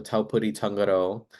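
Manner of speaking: normal talking voice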